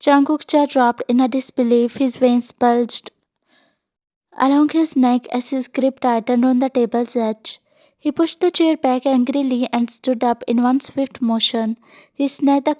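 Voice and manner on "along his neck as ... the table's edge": ordinary delivery, nothing marked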